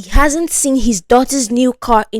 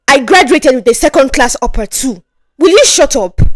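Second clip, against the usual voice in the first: angry snap, higher and louder at the end; snapping; 3 semitones higher, 3 dB louder than usual. Acted. Richard snapping at Ngozi, angry. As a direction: bite back, then explode. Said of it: "But Richard snapped".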